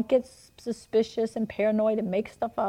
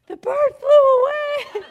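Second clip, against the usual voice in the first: very high, loud, drawn-out mock wonder; feigned amazement; 9 dB louder, slower than usual. Mother fooling her son after tossing the bird, feigned amazement. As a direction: squeal it slowly like a fake miracle.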